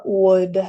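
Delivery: "would" modelled with no L sound; silent L in would. Shown as a demonstration. Teacher shows learners the pronunciation, "would" without L. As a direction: say "would" just like wood. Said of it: "no se pronuncia la L".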